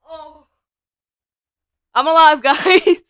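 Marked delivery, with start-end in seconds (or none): 1.9-3.0 laughing. Real, not acted. Laughing at a stunt clip.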